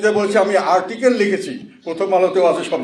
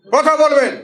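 rallying call; 7 semitones higher, 3 dB louder, slower than usual. Real, not acted. Rallying the crowd to respond.

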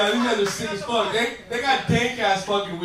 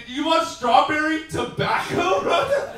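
mock offer; 7 semitones higher, 3 dB louder, slower than usual. Mocking vape offer.

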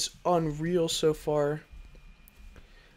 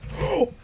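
sharp gasp; 9 semitones higher than usual. Real, not acted. Spots the hunter suddenly.

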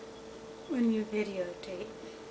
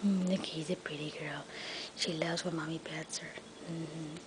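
whispered petting talk; 5 semitones lower, 9 dB quieter than usual. Real, not acted. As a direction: murmur under your breath while petting. Whispering while petting the cat.

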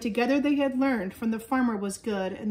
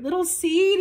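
high, louder anxious call; shouting; 8 semitones higher, 5 dB louder than usual. A narrator's reading. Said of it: "he shouted".

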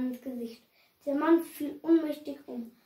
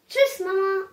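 a higher, louder cheery call; called-out goodbye; 5 semitones higher, 5 dB louder than usual. Acted. Tom leaving for his friend's, calls goodbye to mum. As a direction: call goodbye through the house. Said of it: "rufte er".

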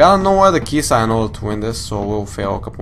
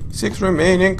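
ordinary delivery

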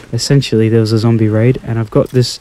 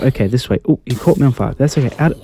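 normal talking voice

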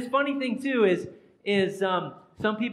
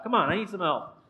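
ordinary talk

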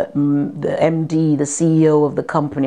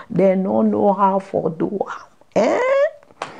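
put-on African accent, ending on a high "Eh?"; 3 semitones higher, slower than usual. Shown as a demonstration. Actress showing off what rivals can't do, in African accent. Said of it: "they can't do an African accent".

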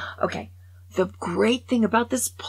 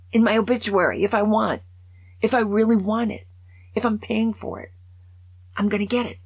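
louder, defiant demand; indignant insistence; 4 semitones higher, 6 dB louder than usual. Real, not acted. Obituary writer defending her ballerina claim, indignant. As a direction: insist on getting what was paid for.

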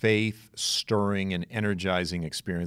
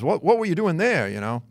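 lightly voiced skeptical New York man, pitched higher; a hint of an incredulous New York accent, up high; mock New York man; 8 semitones higher, 4 dB louder than usual.

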